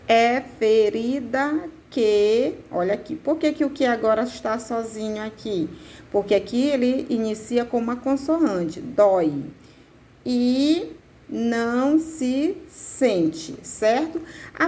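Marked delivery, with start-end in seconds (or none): 0.1-2.5 "É ferida que" at half speed, syllables drawn out; "É... fe-ri-da... que", slow and measured; syllable-by-syllable scansion. Shown as a demonstration. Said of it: "Vamos contar pra ver quantas sílabas poéticas". 10.2-13.6 "E não se sente" very slow, long "E", pauses between beats; "E... não... se... sente", drawn out for counting; syllable-by-syllable scansion. Shown as a demonstration. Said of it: "Vamos contar pra ver quantas sílabas poéticas".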